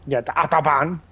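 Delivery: Autobahn said the German way, ah vowels, hard t. Shown as a demonstration. Friend asks why, German pronunciation. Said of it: "the reason I say it like that".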